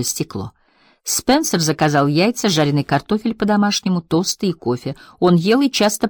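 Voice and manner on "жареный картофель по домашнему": ordinary delivery, nothing marked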